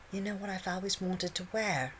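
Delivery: put-on high coy girlish voice.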